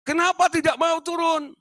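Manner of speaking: very high, loud questioning; doctor asking. Retold or imitated. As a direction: ask why he won't come down. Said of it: "Bilang gini lah".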